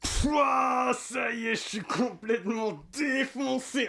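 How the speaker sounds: high, slow stoner voice, drawn out; stoned voice. Shown as a demonstration. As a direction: play it totally high, dragging the words. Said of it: "je vais vous montrer, genre, un petit exemple".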